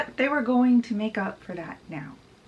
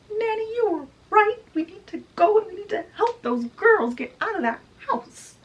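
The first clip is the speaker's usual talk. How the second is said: very high, acted urgency; urgent plea; 12 semitones higher, 3 dB louder than usual. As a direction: plead for action as an upset fairy godmother. Fairy godmother urges rescue of girls.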